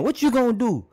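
high-pitched taunting challenge; mock mad. YouTuber voices Kareem confronting Larry Bird, mock mad. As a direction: confront a rival, leaning on the L in Larry. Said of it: "when they saying Larry when they mad".

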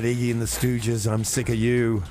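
ordinary talk, nothing marked

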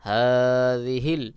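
হাযিহিল drawn out very slowly; joined word reading. Shown as a demonstration. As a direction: stretch হাযিহিল as one long word. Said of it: "spelled then joined". (হাযিহিল ধীরে টেনে পড়া)

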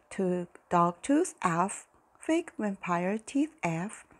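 usual speaking voice

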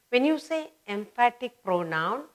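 usual speaking voice

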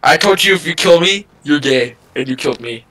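usual speaking voice

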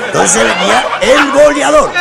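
high, loud, slow proclamation; dramatic announcement. Acted. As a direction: announce him with mock grandeur. Announcing the boleador. (anuncio teatral, agudo y fuerte)

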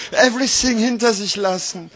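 phrases climbing high in pitch; dramatic slam recital. Acted. Slam poet vowing never to look back, dramatic recital. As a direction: declaim it with rising resolve.

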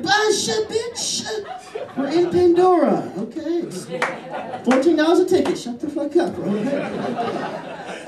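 a moviegoer snapping at a chatty date, high-pitched and annoyed. Acted. Moviegoer hushing a chatty date, high annoyed snap.

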